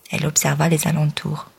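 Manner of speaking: ordinary delivery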